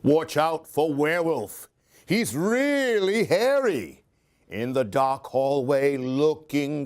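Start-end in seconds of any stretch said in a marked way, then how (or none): none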